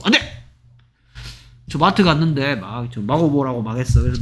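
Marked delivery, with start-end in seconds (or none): 0.0-0.4 sharp, high mock refusal; blunt refusal. Shown as a demonstration. Teacher mimics a rude refusal nobody would give, blunt refusal. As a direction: refuse abruptly in one burst. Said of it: "이렇게 거절하진 않겠죠".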